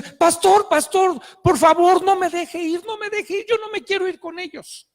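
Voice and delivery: high urgent cry to the pastor, fading in volume; imitated desperate girl pleading; anguished pleading. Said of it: "hecha un mar de lágrimas y angustiadísima".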